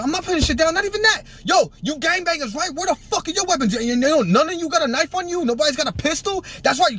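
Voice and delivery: high yelled dare; defiant taunting. Retold or imitated. Retold standoff brandishing a razor.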